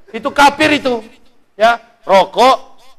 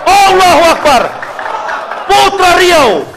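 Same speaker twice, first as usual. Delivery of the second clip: rousing cheer; 6 semitones higher, slower than usual. Acted.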